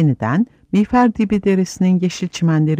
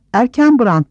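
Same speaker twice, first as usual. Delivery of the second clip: raised, louder cry of riders; excited shout; 8 semitones higher, 5 dB louder than usual. A narrator's reading. Riders hailing Erkenbrand at the horn call, shouting. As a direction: call the name out high and loud. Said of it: "diye bağırdı süvariler".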